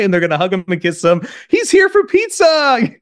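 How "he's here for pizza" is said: very high, slow singsong cheer; imitated kids cheering. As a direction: stretch it out like a gleeful child. Imagined kids cheering for pizza.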